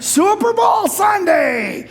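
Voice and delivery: high, loud, stretched-out cheer; excited hype shout. Real, not acted. Preacher hypes America's big day to congregation, excited shout. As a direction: call it out like a big event announcement.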